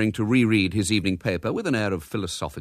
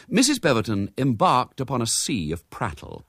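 ordinary delivery, nothing marked

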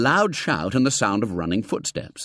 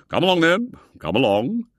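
put-on hearty old gentleman; 8 semitones higher, 5 dB louder than usual. A narrator's reading. Foulard ushering William in.

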